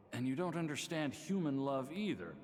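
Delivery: ordinary delivery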